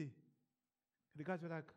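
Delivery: usual speaking voice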